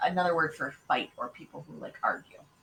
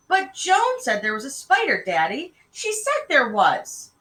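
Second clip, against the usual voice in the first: put-on high, loud little-girl insistence; child's raised-pitch protest; 6 semitones higher, 5 dB louder than usual. A narrator's reading. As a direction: insist to Daddy that it is true. Child protests to her father.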